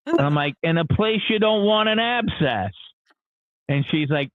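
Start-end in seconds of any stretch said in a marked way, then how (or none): 0.6-2.7 higher-pitched, insistent retold answer; pointed evasive repeat. Repeats evasive answer, being pressed.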